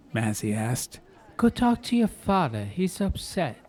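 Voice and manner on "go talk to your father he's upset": high, fussing Italian mom; fussing mother. Acted. Ma greeting her son and his girl, high and fussing. Ma's voice.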